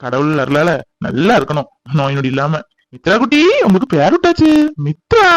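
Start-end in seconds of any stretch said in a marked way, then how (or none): 2.9-5.3 put-on high, delighted baby talk, rising; cooing to baby. Telling the baby she has been named, high cooing. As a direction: coo the new name higher and louder. Announcing new name to baby.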